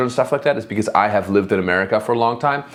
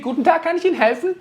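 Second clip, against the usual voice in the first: high, fast, chirpy German greeting; friendly German clerk; faster than usual. Shown as a demonstration. German streamer showing how German shop staff greet you, high and quick. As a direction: greet the customer sweetly, quickly. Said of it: "That's how Germans speak".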